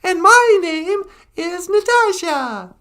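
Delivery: put-on very high, slow, loud puppet girl. Puppet Natasha introducing herself, very high and loud. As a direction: squeak it out as the puppet.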